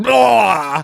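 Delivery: loud fake retching blah; mock vomiting noise, high and loud; puke sound. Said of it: "I'm making a puke sound".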